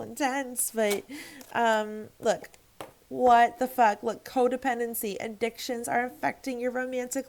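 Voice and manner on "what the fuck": loud, startled what the fuck; sudden loud swear; loud outburst. Real, not acted. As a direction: blurt it out much louder.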